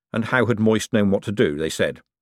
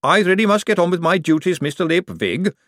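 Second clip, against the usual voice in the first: reproachful golem; 8 semitones higher, 3 dB louder than usual. Acted. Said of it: "said the Golem reproachfully".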